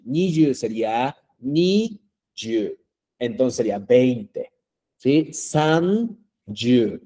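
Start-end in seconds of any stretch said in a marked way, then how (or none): 0.0-2.8 ni yu said slowly, split into two clear parts; corrected form. Shown as a demonstration. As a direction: slowly separate ni yu to show the fix. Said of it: "Lo que está mal". (ni yu despacio, en dos partes claras)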